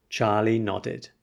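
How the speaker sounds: plain reading voice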